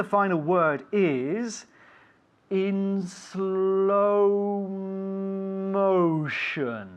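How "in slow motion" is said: extremely stretched, drawn-out syllables; slow motion. Shown as a demonstration. Teacher introduces a new phrase to pupil, in slow motion. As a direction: draw each word out as if slowed down. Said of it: "I will say things in slow motion".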